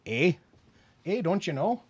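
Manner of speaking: mild mock Fargo-style Minnesota accent; a light Minnesota 'eh' put-on; playful half-hearted Fargo accent. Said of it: "I have seen the movie Fargo".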